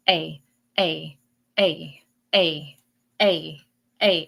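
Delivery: "A" repeated in separate, evenly spaced beats; "A" over and over, a short pause after each; insistent repetition. Shown as a demonstration. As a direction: say each "A" alone, like a signal that keeps pulsing. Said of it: "See how it's coming through like that".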